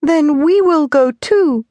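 put-on high, slow hopeful little boy; innocent child. A narrator's reading. Tonelli answering his mother, high and hopeful. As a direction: say it trustingly like a child. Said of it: "replied Tonelli".

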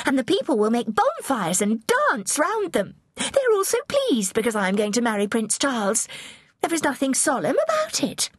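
put-on high, emphatic girlish voice, full of excitement; eager young princess. A narrator's reading. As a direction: play an excited, headstrong girl. Voices young Mary to Catherine.

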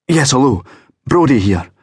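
gasping into the phone, winded from running down; gasped. Acted. Out of breath from the stairs, answering the phone gasping. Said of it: "I gasped".

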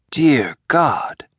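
ordinary delivery

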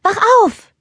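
high, loud wake-up call to her sister. Acted. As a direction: call your sister awake across the room. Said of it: "rief sie". (laut und hoch rufend)

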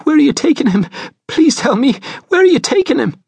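high, quavering, desperate plea. A narrator's reading. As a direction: beg as a frightened mother, voice pitched high. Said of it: "her voice quavering".

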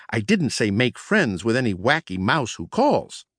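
ordinary delivery

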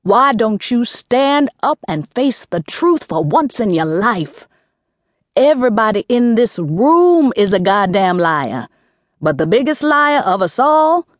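lightly voiced jailhouse aunt, barking. A narrator's reading. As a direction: bark it at her like a cellblock tough. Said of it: "barked in her jailhouse voice".

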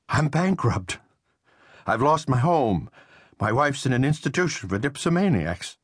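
high, strained voice of a broken man; desperate ruined Riley. A narrator's reading. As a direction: spill his ruin in a high, cracking plea. Riley pours out his ruin.

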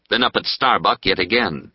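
ordinary delivery

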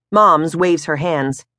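plain reading voice

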